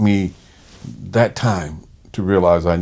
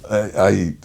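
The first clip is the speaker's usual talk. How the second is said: ordinary delivery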